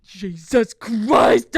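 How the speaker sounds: loud, slow, drawn-out exclamation; exasperated outcry. Real, not acted. Podcaster appalled for wives watching, exasperated outcry. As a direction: stretch both words out in outrage.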